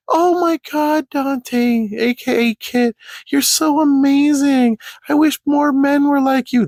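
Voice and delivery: very high, slow, swooning falsetto; mock gushing girl. Girl flattering Kit in chat, mock gushing falsetto. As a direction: gush adoringly in an exaggerated girly falsetto. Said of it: "That's literally how this girl is talking back".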